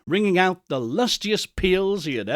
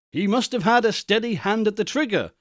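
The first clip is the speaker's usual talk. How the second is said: ordinary delivery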